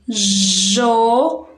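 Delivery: JO held long as one sound; single syllable. Shown as a demonstration. Teacher isolating the first sound for pupils, drawn-out syllable. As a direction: stretch out jo on its own. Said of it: "um som".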